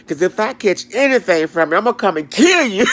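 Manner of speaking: high, loud, hammed-up threat; mock threat. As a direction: threaten them half in jest. Retold threat.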